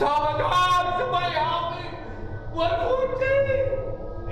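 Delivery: high, stretched-out terrified cry; panicked scream for help. Retold or imitated. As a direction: imitate her shrieking for help as she runs out. Donna runs out screaming.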